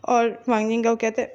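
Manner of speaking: ordinary delivery, nothing marked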